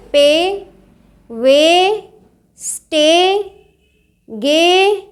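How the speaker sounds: "पे, वे, स्टे, गे" each with a long-held ay; lengthened ay. Shown as a demonstration. Teacher drilling long ay for learners, "पे, वे, स्टे, गे" drawn out. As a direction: stretch the ay in "पे, वे, स्टे, गे", one by one. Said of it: "कुछ लंबा सा स्व".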